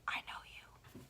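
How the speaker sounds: hushed knowing whisper. Retold or imitated. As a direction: breathe it like a secret. Imagined celebrity recognition line.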